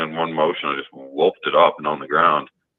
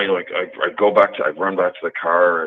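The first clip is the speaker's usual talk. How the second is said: ordinary talk, nothing marked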